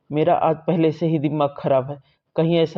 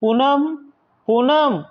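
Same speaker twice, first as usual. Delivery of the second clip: calling the wife loudly, pitched much higher; shouting a name; 9 semitones higher, 4 dB louder, much slower than usual. Acted. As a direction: yell the name across the house. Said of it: "इतना क्यों चिल्ला रहा है". (ज़ोर से पुकारते हुए)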